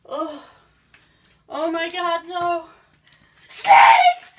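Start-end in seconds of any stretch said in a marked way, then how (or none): 3.5-4.3 shrieked, much higher and louder with a laugh; panicked scream. Acted. Spotting a snake in the basement, hammed-up shriek. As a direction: yell it like you've just seen a snake.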